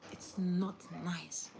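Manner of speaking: very slow and soft; slow quiet reproach. Shown as a demonstration. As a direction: stretch it out gently. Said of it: "repeated delivery takes".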